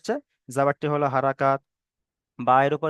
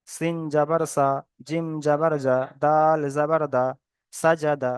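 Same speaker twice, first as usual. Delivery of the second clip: measured syllable-by-syllable spelling drill; spelling recitation; slower than usual. Shown as a demonstration. Teacher shows students how to read joined letters, measured spelling recitation. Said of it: "এইভাবে পড়তে হবে".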